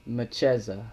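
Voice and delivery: Mecheza as muh-CHEZ-a; her own pronunciation. Shown as a demonstration. Admitting her own version to viewers, Mecheza pronounced with a ch. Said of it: "So I've been saying".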